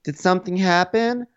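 high, fake-innocent question; mock Melissa. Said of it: "Melissa's like".

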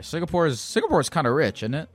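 normal talking voice

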